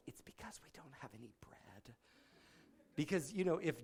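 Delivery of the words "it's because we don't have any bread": mumbled disciples. Retold or imitated. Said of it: "They're like".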